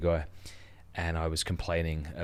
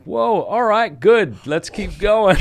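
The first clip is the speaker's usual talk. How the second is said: high, loud startled whoa, laughing it off; 13 semitones higher, 10 dB louder, much slower than usual. Retold or imitated. Hearing a friend say he deserves hell, high loud mock shock. Startled reaction to harsh remark.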